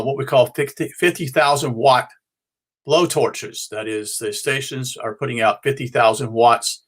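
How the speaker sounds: usual speaking voice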